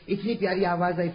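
ordinary delivery

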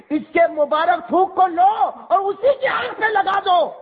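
a priest shouting his proclamation to the grandfather; full-force preaching. Acted. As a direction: announce the last prophet in ringing cries.

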